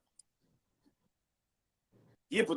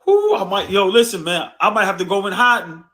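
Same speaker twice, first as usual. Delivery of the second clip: normal talking voice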